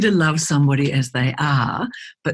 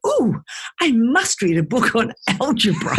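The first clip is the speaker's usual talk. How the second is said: high, eager "ooh" of pretend delight; hammed-up keenness, pitched up; mock enthusiasm; 5 semitones higher than usual. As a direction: play someone absurdly thrilled by a dull treat. Mocks anyone choosing algebra for leisure.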